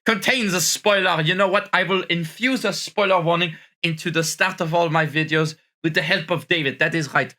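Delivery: hammed-up French accent on English; put-on French accent. French persona greeting his viewers, in a put-on French accent. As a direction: play a tired Frenchman mangling English. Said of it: "this French accent".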